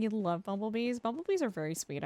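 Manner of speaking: ordinary talk, nothing marked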